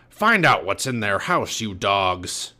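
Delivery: loud, commanding pirate voice; pirate captain order. Acted. Captain Bones sends his crew searching, pirate command.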